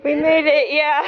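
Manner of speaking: high, loud, laughing with joy; excited laughing. Real, not acted. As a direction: celebrate the summit, laughing. Meeting hikers at summit.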